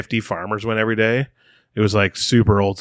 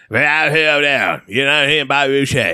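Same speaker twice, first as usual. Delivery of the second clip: put-on Louisiana accent; 4 semitones higher than usual. Showing his own version, exaggerated Louisiana Cajun accent. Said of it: "I do Louisiana".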